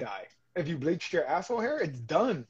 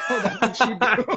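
laughing surprised; 6 semitones higher, 6 dB louder than usual. Retold or imitated. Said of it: "I was like".

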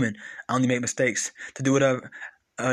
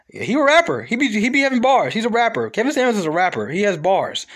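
loud, high, insistent; emphatic shout; 6 semitones higher, 4 dB louder than usual. Real, not acted. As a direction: hype it up loudly.

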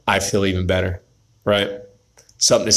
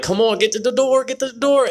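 much higher, urgent self-urging; urgent hurry-up; 13 semitones higher than usual. Retold or imitated. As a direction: egg yourself on to reach the door in time. Urging himself while biking.

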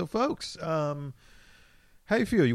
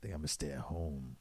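low quiet mumble; 9 semitones lower, 11 dB quieter than usual. Retold or imitated. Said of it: "it's like".

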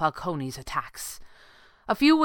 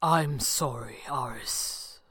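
low, hushed voice; soft apology; 5 semitones lower, 7 dB quieter, slower than usual. A narrator's reading. Stranger to his doomed rival, soft apology. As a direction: say it softly, sinking away. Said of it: "the stranger said softly".

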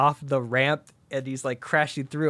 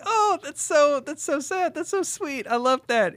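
high, cooing admiration; mock gushing; 13 semitones higher than usual. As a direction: gush like touched onlookers. Friends gush over love confession.

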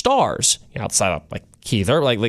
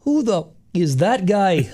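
slow, higher-pitched Irish accent; Conor McGregor; 5 semitones higher, slower than usual. Shown as a demonstration. Host showing off his best accent, Irish fighter's voice. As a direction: challenge them in a drawn-out Irish brogue. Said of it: "do a really good Conor McGregor".

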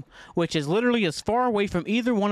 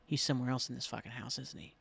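low, very soft muttering; hushed suspicion; 5 semitones lower, 12 dB quieter than usual. Retold or imitated. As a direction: mutter the suspicion to yourself, trailing away. Suspicious thought while searching.

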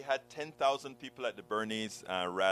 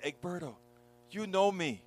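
upset friend; 6 semitones higher, 4 dB louder than usual. Retold or imitated. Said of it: "she comes to me crying".

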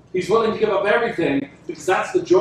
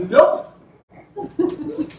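laughing nope; 9 semitones higher than usual. Retold or imitated. Apostles refusing Jesus's plain words.

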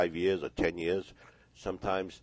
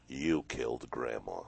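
accusing inner voice; 6 semitones lower, 5 dB quieter, slower than usual. Retold or imitated. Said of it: "that imagination mind says".

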